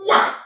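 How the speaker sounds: loud, abrupt "whack"; sudden punched sound effect; diving strike noise. Retold or imitated. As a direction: imitate a bird smacking the water. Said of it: "and goes".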